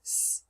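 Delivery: "s" as a bare hiss, not the letter name; isolated s sound. Shown as a demonstration. Teacher shows the sound ending rinse, isolated "s". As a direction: hiss "s" alone with no vowel. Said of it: "how to make the s".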